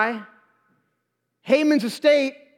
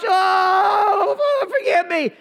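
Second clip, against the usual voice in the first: begging; 7 semitones higher, much slower than usual. Acted. Haman falls on the couch begging, mock wailing. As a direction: wail for mercy, drawn-out and hammed-up. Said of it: "he's begging".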